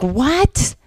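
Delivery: high, startled yelp of a question; mock stunned husband; shocked what. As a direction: blurt it out in disbelief. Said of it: "And he's like".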